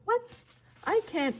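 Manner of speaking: high, squeaked disbelief; high-pitched dismayed protest; incredulous refusal. A narrator's reading. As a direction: balk in alarm. Boy refuses the task.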